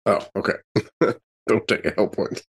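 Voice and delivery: ordinary delivery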